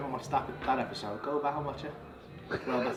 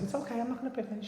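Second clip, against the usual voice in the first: soft, higher-pitched coaxing; gentle reassurance; 7 semitones higher, 8 dB quieter, faster than usual. Retold or imitated. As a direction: coax a shy person softly, acted out. Reassuring a shy bride.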